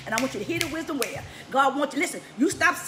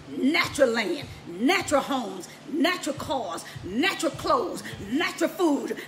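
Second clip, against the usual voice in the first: ordinary delivery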